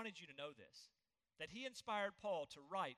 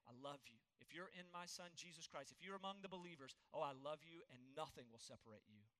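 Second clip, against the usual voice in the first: soft, lower and quieter, gently reassuring; God's tender voice; 4 semitones lower, 7 dB quieter than usual. Acted. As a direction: speak as God comforting his child. God voiced speaking tenderly to believers.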